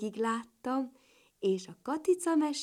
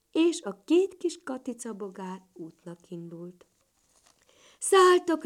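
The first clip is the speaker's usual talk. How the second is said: ordinary delivery, nothing marked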